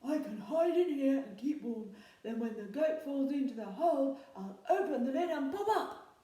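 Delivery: a troll delighted with his clever hiding plan. A narrator's reading. Troll plots hiding in the chest, loud and pleased. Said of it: "said the Troll".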